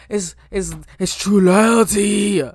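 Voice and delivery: awed, loud, slow declaration; proclaiming. Real, not acted. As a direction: declare it like a verdict. Emphatic moved declaration.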